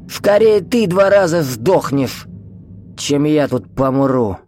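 high, slow spiteful retort; angry. A narrator's reading. Old man taunting a corpse, spiteful and angry. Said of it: "зло сказал старик трупу".

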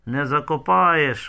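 usual speaking voice

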